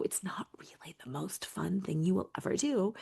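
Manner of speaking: a hushed, breathy aside to the listener; whispered aside. Real, not acted. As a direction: drop into a confiding whisper. Aside about packing and moving.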